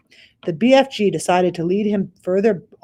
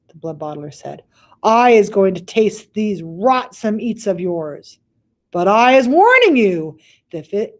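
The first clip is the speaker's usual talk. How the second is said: threatening giant; 4 semitones higher, 6 dB louder than usual. Acted. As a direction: warn with a threat. Bloodbottler warns threateningly.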